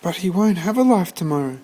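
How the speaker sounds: put-on boy pleading with grandmother, high and desperate; pleading George. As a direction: plead urgently like a desperate kid. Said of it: "said George".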